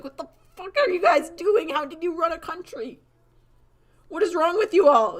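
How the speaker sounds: loud, high-pitched disbelief; mock appalled outburst. Newcomer appalled by the crazy art kids, mock outrage. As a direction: berate them in hammed-up exasperation.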